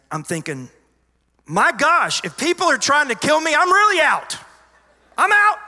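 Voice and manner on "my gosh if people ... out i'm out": very loud, high-pitched yell; exasperated shout. Retold or imitated. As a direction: yell it at full volume, voice way up. Acted shouted reaction to murder attempt.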